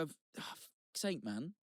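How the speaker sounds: slow, exasperated sigh of a curse; frustrated. Retold or imitated. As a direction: grumble it softly to yourself, sinking at the end. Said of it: "I'm getting frustrated".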